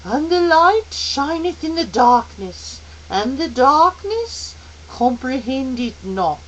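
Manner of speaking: high, loud, slow declamation; grand, drawn-out recitation; triumphant quotation. Acted. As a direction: declaim scripture with ringing pride. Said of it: "shone out at them triumphantly".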